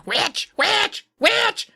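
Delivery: high mock scream. A narrator's reading. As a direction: squeal the accusation repeatedly. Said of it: "keeps screaming".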